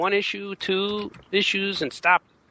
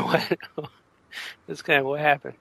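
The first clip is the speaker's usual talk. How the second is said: ordinary talk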